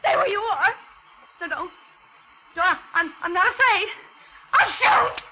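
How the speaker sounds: high, desperate, frightened pleading threats; panicked accusation. Acted. Captive woman threatening the murderer, high and panicked. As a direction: hold him off, voice high with panic. Accusing killer, gun in hand.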